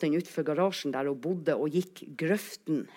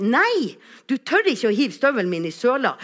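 put-on loud, raised child's protest; acted indignant dare; 5 semitones higher, 6 dB louder than usual. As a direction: challenge the teasing friend loudly. Friend dares in acted quarrel. (høylytt, trassig utfordring)

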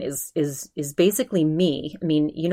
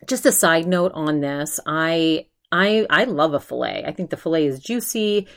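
usual speaking voice

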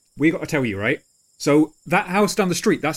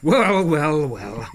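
smug, drawn-out gloating; self-satisfied drawn-out well; much slower than usual. Acted. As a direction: lean back and gloat. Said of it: "rocks smugly back on his haunches, like".

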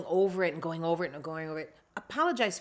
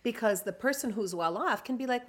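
normal talking voice

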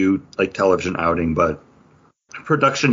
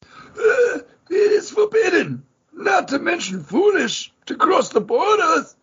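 much higher, slow, solemn character voice with a cry in it; dramatic reading; slower than usual. Acted. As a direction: intone the warning like a grave old storyteller, high and slow. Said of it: "I cleared my throat to do that".

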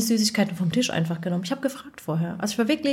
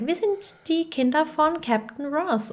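put-on a touch of a child character, pitched higher; lightly voiced Boss Baby; 7 semitones higher than usual. Kid to stewardess in business class, lightly voiced Boss Baby. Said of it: "Und dann sagt er".

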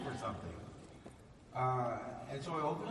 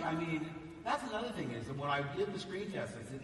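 ordinary talk

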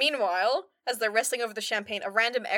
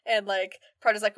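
usual speaking voice

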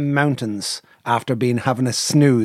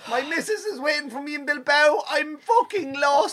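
panicked; 11 semitones higher, 4 dB quieter than usual. Retold or imitated. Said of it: "I'm there going".

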